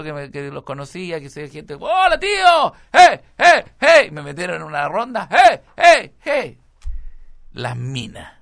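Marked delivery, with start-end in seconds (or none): 1.7-4.1 high, very loud mock cheering; griterío imitado. Classmates cheer him, retold. 5.3-6.6 high, loud chanted mock cheers; coro imitado. Kids chant around him, retold.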